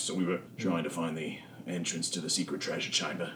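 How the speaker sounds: low, soft, grumbling henchman; a bored crook spilling his orders; low surly thug Knocker. Acted. Captured thug Knocker answering the party, low and grumbling.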